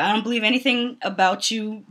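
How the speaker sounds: usual speaking voice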